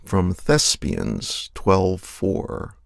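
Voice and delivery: normal talking voice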